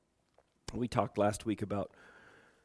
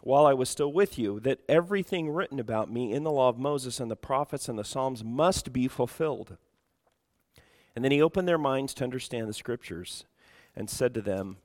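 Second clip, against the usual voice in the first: louder, projected Bible reading; scripture reading; 3 semitones higher, 6 dB louder than usual. Real, not acted. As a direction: read the verse out firmly to the congregation. Reads scripture aloud to congregation.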